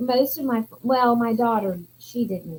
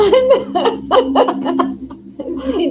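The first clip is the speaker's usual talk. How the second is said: laugh; 8 semitones higher, 9 dB louder than usual. Real, not acted.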